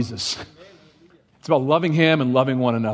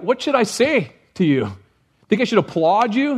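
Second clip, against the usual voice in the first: sarcastic Paul; 6 semitones higher than usual. Retold or imitated. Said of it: "Paul's like".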